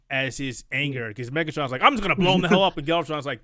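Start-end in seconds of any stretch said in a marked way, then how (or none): 1.8-2.8 louder, higher hothead threat; mock angry. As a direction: play the fuming warlord, pushed up. Angry Megatron quoted.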